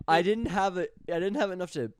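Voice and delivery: voice cracking. Real, not acted. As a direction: voice breaking on "have". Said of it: "my voice broke twice saying "have".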